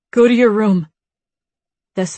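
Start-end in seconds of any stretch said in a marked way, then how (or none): 0.1-0.9 firm. Acted. Firm order to Nate.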